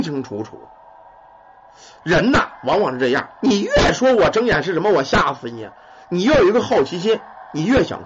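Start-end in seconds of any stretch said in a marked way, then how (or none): none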